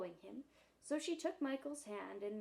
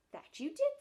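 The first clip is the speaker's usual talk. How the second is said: much higher, louder good-natured bear; cheerfully agree, bear to child; amused bear; 11 semitones higher, 7 dB louder than usual. Acted. Bear voiced in story.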